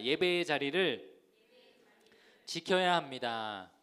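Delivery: slow, loud lead for the congregation to echo; slow, emphatic call-and-repeat. Shown as a demonstration. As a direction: draw out each phrase firmly for them to repeat. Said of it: "한번 따라해 보겠습니다".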